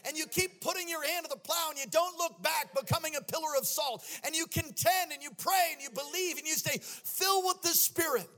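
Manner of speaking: loud, high, sing-song preaching; preaching at full force. Acted. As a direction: build it like a revival climax. Climactic preaching with music.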